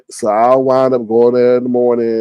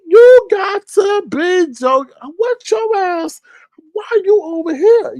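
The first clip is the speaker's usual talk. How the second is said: incredulous; slower than usual. Retold or imitated. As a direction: squeal like you can't believe your eyes. Confronts the man from the bougie gym.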